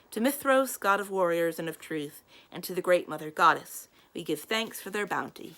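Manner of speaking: loud, intoning grace before a hall; solemn prayer. Acted. Lord Wilden leading grace before the pages, loud solemn intoning. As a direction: proclaim it like a lord leading worship.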